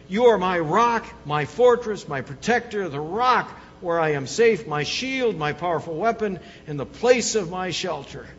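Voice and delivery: loud, ringing reading of a psalm; proclaiming scripture. Acted. Preacher to congregation, loud proclaiming. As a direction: declare each title of God out loud.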